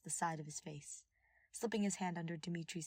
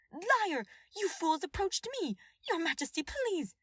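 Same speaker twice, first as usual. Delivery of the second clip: high, loud frantic shouting; desperate outburst; 12 semitones higher, 7 dB louder than usual. A narrator's reading. Cornelia shouting at Clemen and begging the king, frantic. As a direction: scream and beg at once. Cornelia being accused, pleading.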